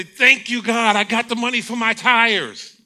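hammed-up holy joy over the money; mock ecstatic praise. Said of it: "Holy Ghost dance".

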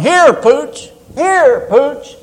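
high-pitched calling-out to a dog; hollering for dog. Acted. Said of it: "he's standing there hollering".

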